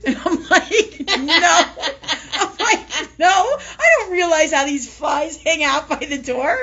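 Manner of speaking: high, loud, laughing through the words; can barely get it out for laughing; laughing hard. Real, not acted. Laughing retelling funny exchange.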